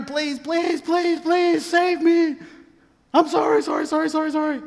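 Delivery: desperate acted apologies tumbling out; frantic begging. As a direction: beg for your life, hammed up. Haman begging queen for his life.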